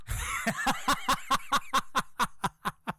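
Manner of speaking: high breathy gloating laugh; wife's cackle. Retold or imitated. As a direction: cackle in triumph. Wife cackles.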